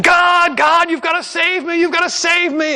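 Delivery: high, strained begging; man on the roof begging God for rescue; desperate plea. Acted. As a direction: cry to God from the rooftop.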